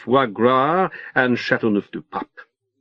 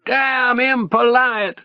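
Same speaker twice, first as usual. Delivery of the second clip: high, slow scream over the engine roar; 12 semitones higher, slower than usual. A narrator's reading. Leo screams into the narrator's ear over the roaring boat, high slow yell. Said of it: "he screams".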